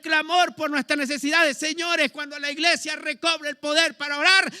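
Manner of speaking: high, impassioned preacher's exhortation; fiery preaching. Acted. Pastor urging the church to pray, fiery high preaching.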